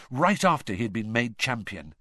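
ordinary delivery, nothing marked

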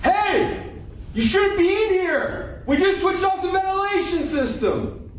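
high, loud and slow shout; still yelling. Acted. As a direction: screams it though asked to calm down. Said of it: "don't yell quite as loud".

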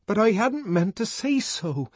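high anguished cry; cried out. Acted. The savage protesting to Lenina, crying out. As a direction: cry it out in agony. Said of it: "cried the savage".

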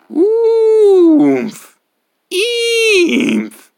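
very high, loud squeal. Acted. Reader forced into nonsense sounds, very high loud squeal. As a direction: squeal it like a kettle.

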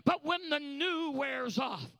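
relentless loud sermon climax; full-force preaching. Real, not acted. Preacher warning a friend about substitutes, building full-force preaching. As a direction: build to a shouted, rhythmic peak.

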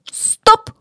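high, abrupt cry of halt; sudden shout. A narrator's reading. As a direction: shout to stop someone in their tracks. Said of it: "крикнул я".

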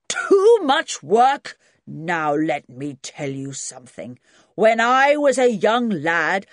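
put-on loud commanding old grandad. Grandad scolding young people about work, loud and gruff. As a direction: bellow like a cranky old farmer. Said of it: "set the old man off again".

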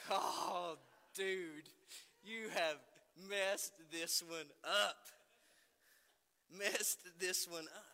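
slow, loud dismayed self-scolding; self-rebuke. Retold or imitated. Father scolding himself after seeing his mom's look, slow and dismayed. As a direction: drag out the realization you blew it. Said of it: "I'm just like".

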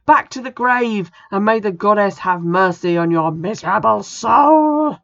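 high, loud furious banishing cry; commanding exorcist yell; screaming banishment. Acted. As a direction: scream the ghost back to the grave.

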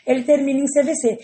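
ordinary talk

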